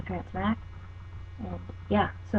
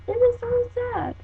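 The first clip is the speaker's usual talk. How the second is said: high, slow, overdone whine; mock sad whine; slower than usual. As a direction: whine it like it's a tragedy. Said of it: "And I'm like".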